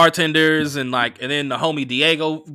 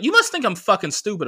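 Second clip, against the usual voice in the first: mock indignant, higher pitch; retold cussing out; 7 semitones higher than usual. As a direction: imitate her offended accusation, pitched up. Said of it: "She's cussing me out on the phone".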